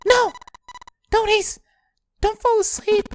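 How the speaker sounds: put-on high, begging girl; desperate girl voice. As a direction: plead him to stay awake. Voices June.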